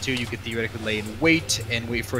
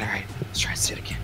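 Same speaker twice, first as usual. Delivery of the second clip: ordinary talk, nothing marked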